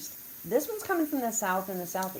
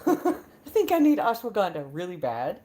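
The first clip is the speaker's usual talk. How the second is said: laughing; 7 dB louder than usual. Real, not acted. Laughing about her stress.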